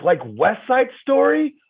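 hammed-up high kid voice; excited kid voice. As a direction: jump at it like a thrilled theatre kid. Kid voiced in retold scene.

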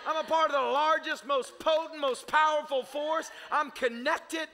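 loud, high, shouted declarations; preaching at full force. Acted. Pastor rallying the congregation with a loud declaration. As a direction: preach it out, building to a roar.

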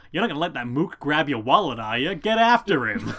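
hammed-up New York vendor egging someone on; ludicrous New York accent. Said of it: "ludicrous New York accents".

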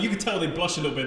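ordinary talk, nothing marked

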